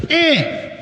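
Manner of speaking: short, high-pitched grunt of complaint; Mama Ella. Retold or imitated. Imitating mama's pet expression, high and dismissive. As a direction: squeak it out in a little high voice. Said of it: "As my mama Ella used to say".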